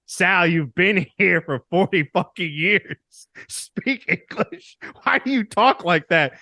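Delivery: slow, hammed-up exasperated telling-off; exasperated scolding. Mom telling Sal to speak English, exasperated. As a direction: imitate a fed-up mom, pitch climbing.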